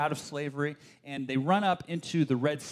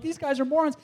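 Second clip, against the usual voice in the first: high scoffing repeat; 11 semitones higher, 4 dB louder than usual. Retold or imitated. Young reader scoffing at the Israelites again, high. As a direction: toss it off with contempt. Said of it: "I'm like".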